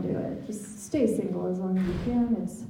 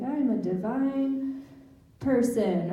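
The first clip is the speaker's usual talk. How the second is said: slow, higher-pitched mock mantra; drawn-out imitation of self-love mantra; mock affirmation; 3 semitones higher, slower than usual. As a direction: intone it slowly like a meditation phrase. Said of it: "phrases that she told me to say".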